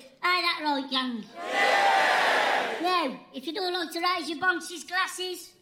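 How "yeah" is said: crowd cheer. Acted.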